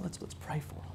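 whispered, voiceless and soft; hushed prayer invitation. Retold or imitated. Father whispering to his daughter before church, hushed. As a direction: whisper it gently to your little girl. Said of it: "I said".

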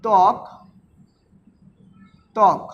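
ত্বক said "tok", the ব dropped; one short, careful "tok" with no w sound; silent ব-ফলা. Shown as a demonstration. Teacher showing an example to students, ত্বক as careful "tok". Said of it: "বটা উচ্চারিত".

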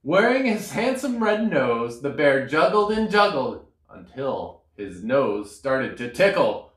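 plain reading voice

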